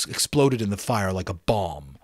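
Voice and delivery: plain reading voice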